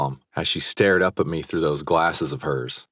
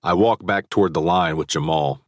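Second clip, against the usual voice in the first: ordinary delivery, nothing marked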